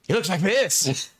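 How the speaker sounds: high, squealing Pee-wee laugh; Pee-wee impression. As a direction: squeal it like a manic man-child. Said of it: "Just does the fucking".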